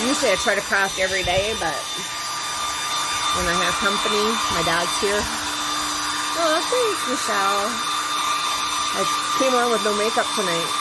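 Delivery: talking loudly over dryer noise; over hair dryer. Real, not acted. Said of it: "so please watch your ears".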